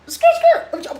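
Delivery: high, loud scandalised excuse me; outraged shriek; shocked outrage. Acted. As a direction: squeal it in disbelief. Shocked at being called hoe.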